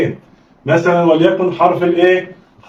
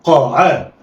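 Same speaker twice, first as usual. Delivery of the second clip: قا عا snatched together; blended quickly; 3 semitones lower, slower than usual. Shown as a demonstration. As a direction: run قا and عا into one. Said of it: "يلا، اخطف".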